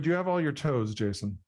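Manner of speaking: normal talking voice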